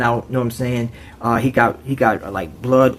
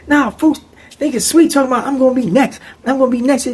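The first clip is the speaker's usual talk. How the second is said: high, complaining; mock young inmate; 11 semitones higher, faster than usual. Young boy telling the officers, high mock voice. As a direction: tattle to the guards.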